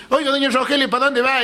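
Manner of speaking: put-on high-pitched call to a passing old lady; acted street-corner greeting, pitched well up; hailing a neighbour. Man on corner hails old woman.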